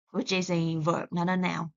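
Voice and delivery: normal talking voice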